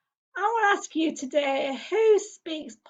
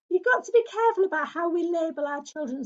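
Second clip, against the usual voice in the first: usual speaking voice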